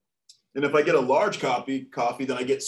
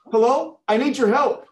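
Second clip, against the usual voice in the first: high, loud emergency caller; urgent caller; 9 semitones higher, 8 dB louder than usual. Acted. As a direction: act out an urgent plea to the operator. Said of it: "The man calls nine one one".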